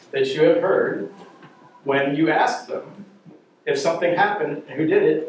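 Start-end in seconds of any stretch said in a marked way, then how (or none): none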